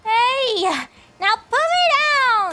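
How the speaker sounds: protesting. Acted. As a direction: squirm and protest being carried.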